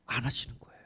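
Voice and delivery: soft, voiceless whisper; hushed whisper. Real, not acted. Preacher reveals God's silence to the congregation, hushed whisper. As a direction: drop to a whisper after a long pause. Hushed answer after rhetorical question.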